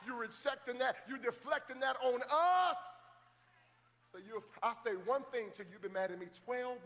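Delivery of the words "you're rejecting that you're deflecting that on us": louder, higher shouted line; rising preacher shout; preaching shout. Real, not acted. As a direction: drive the point home with a shout.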